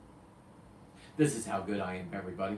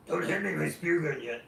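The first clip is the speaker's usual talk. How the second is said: lightly voiced old diver; imitating Tom; 4 semitones higher than usual. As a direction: quote buddy in a slightly put-on voice. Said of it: "I don't know why I just did that".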